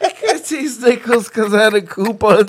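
high strained laugh-talk. Real, not acted. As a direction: push words through laughter. Laughing fit.

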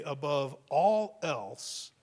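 very slow "Above all else", then a long pause; "Above all else" drawn out, stress falling on "all". Shown as a demonstration. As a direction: say "Above all else" slowly and let it hang in silence. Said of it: "the pause there is, not meant to be long or awkward".

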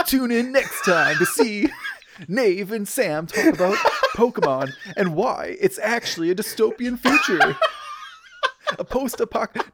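slow, high, laughing announcer; hammy anime narrator voice. Pretend. Mock Pokemon narrator outro.

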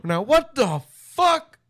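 slow, high, indignant teacher voice; outraged teacher. Pretend. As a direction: play a shocked teacher.

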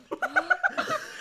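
high shriek of laughter. Real, not acted. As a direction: burst into a squealing laugh.

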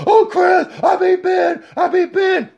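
high, gushing brag; mock giddy Fury. Mocking Fury telling Chris about beating Ben, high giddy brag. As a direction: squeal the news like a kid.

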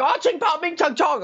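high mock Chinese babble; mock Chinese gibberish. Customer says something in Mandarin, as high mock gibberish. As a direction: parody Mandarin, pitched high. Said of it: "I'm sorry for the racism".

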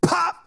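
sharp, high mimicked pop; loud, sudden rocket pop; imitated firecracker pop. Comic telling how a bottle rocket goes off, imitated pop.

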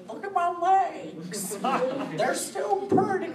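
very high, loud, slow granny voice; mock old cosplayer. Seventy-year-old cosplayer showing off, mock old-lady boast. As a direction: screech it proudly, dragging out each word. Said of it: "in a walker when I'm seventy years old".

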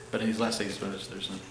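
very low, soft recap; under the breath. Real, not acted. Preacher recounting the thirteen words, under the breath. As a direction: murmur it low, almost to yourself.

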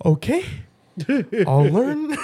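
mock-sulky child giving in; mimicking his kid. Dad imitating his son giving in, high sulky kid voice.

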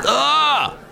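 high exasperated "ah!"; disgusted cry. Real, not acted. Reacting to the fake sexy voice, high disgusted cry. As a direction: let out a high disgusted yelp. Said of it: "and it sounds".

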